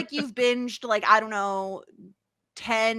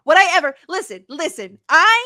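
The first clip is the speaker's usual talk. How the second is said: louder, higher mock outrage; mock indignant; 6 semitones higher, 6 dB louder than usual. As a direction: play up the offended defender.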